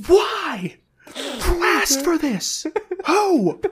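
high, straining comic outrage; incredulous outrage. Real, not acted. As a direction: rant in pained, cracking disbelief. Incredulous rant about the comic.

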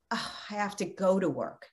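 mock grudging sigh of "have to go to work"; a soft, dragging, put-upon "Oh, I have to go to work"; lightly acted reluctant moan; grudging complaint. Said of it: "Instead of saying".

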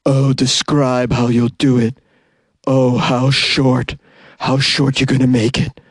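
slow, creepy, husky put-on voice; husky caller voice. Caller asking the barber about the haircut, creepy husky voice. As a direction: linger eagerly in a creepy husky voice. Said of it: "She said".

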